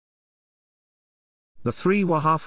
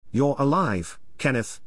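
ordinary delivery

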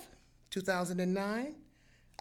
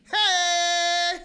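panicked yell; 6 semitones higher, 8 dB louder than usual. Retold or imitated. Panicked cry in embassy.

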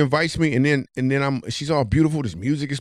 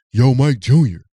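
calling out; 7 dB louder than usual. Retold or imitated. Bouncer calling out across street.

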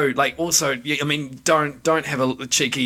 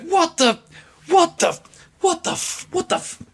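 squeaky, much higher voice, sputtering the words; Doug impersonation. Retold or imitated. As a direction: Doug so shocked he keeps choking on the swear, pitched way up. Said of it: "my best Doug impersonation".

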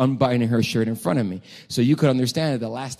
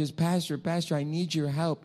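higher, softer imitation of a pleading girl; 5 semitones higher, 6 dB quieter than usual. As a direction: lightly act out her plea for help. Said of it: "she says".

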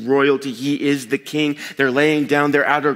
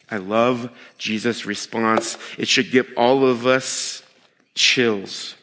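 normal talking voice

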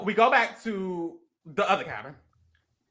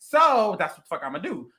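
inner resolve; 6 semitones lower, 5 dB quieter than usual. Retold or imitated. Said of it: "She's like".

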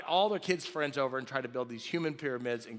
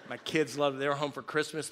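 ordinary talk, nothing marked

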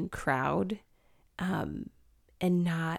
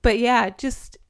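higher, louder, welling up; getting emotional; 5 semitones higher, 6 dB louder than usual. Real, not acted. Speaker surprised by her own feelings, getting emotional. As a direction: push on through real emotion. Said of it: "I don't know why I'm getting emotional".

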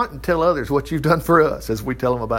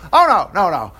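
flustered refusal; 2 semitones higher, 4 dB louder than usual. Retold or imitated. Questioned leaders refusing to answer, flustered mock refusal. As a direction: back away fast from the question, acted.

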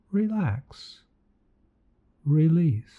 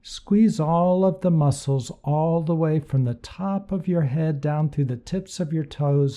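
usual speaking voice

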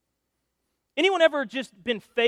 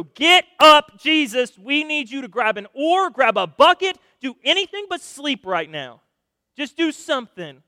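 loud, higher-pitched plea to wake up; urgent yelling; 4 semitones higher, 9 dB louder than usual. Acted. Disciples to sleeping Jesus in the storm, urgent yelling. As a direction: shout it like the boat is sinking.

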